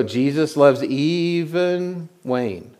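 slow, drawn-out sung line; imitating Wayne's phrasing. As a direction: mimic how Wayne sang it, slowly. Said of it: "That's how he said it".

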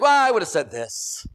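slow, loud, smug correcting; know-it-all correction. Retold or imitated. Churchgoer second-guessing the sermon, slow smug drawl. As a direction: drag it out like you know better.

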